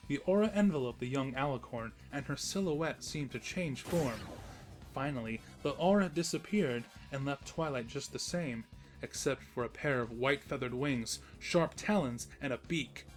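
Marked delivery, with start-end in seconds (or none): none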